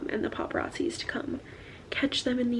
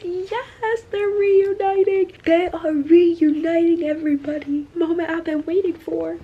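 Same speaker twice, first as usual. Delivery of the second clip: excited cheer; 5 semitones higher, 8 dB louder than usual. Real, not acted. Reader celebrates the long-awaited reunion, excited cheer. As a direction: shout the good news to everybody.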